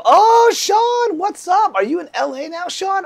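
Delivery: light up and call out his name, pitched high; excited greeting. Real, not acted. Greeting old student Sean in the live chat, excited high call-out.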